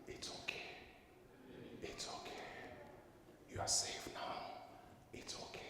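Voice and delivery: soft whispered reassurance; soothing whisper. Retold or imitated. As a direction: whisper comfort to a frightened child. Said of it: "And she kept saying".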